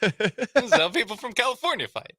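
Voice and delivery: mock Californian fighter. Acting out fighting styles by state.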